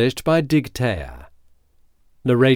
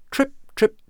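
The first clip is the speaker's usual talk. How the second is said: tiny squeaky hooves; light little trotting steps; high trip trip; 14 semitones higher than usual. A narrator's reading. Tiny goat hooves sound.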